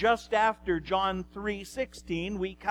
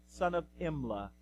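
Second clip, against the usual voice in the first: normal talking voice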